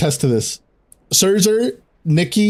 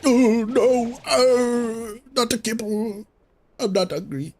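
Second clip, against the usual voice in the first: silly pet voice; 9 semitones higher, 4 dB quieter, slower than usual. Pretend.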